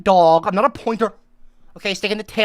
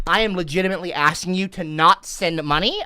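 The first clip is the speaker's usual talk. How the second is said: ordinary talk, nothing marked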